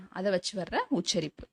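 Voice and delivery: ordinary talk, nothing marked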